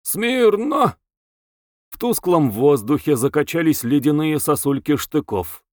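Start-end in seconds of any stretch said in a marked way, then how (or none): 0.0-1.0 high, drawn-out military order; wailing drill-sergeant call; howling command. A narrator's reading. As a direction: bellow a parade command, pitched high. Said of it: "влетела воющая команда".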